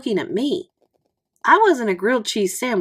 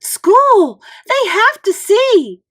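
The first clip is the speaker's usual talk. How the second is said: much higher and louder, slower excited cry; excited call; 12 semitones higher, 8 dB louder, much slower than usual. A narrator's reading. Judy pulling the boy to school, thrilled. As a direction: urge him along, bursting to show off. Judy eager to show everyone.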